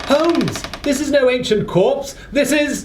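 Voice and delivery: shocked outcry. Acted. Watson cries out to Holmes over the opened coffin, alarmed shout. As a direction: blurt it out in horror at the discovery.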